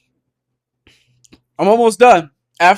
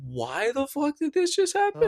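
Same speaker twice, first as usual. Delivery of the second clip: high, soft, bewildered question; mock-baffled, pitch lifting way up; confused; 8 semitones higher, 13 dB quieter than usual. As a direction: ask it small and high, totally lost. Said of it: "I was so mad confused".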